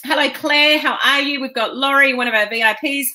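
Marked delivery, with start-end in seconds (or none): none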